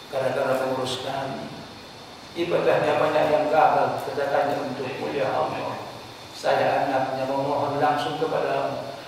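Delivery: low, soft, earnest prayer for a parent; child's heartfelt prayer. Shown as a demonstration. A child prays for a dead parent, soft and earnest. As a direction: pray softly for a late father, from the heart. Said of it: "Orang tua anaknya lah yang paling pas".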